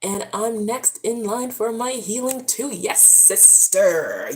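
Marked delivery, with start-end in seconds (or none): 2.7-4.3 sassy affirmation. Real, not acted. Affirming a viewer.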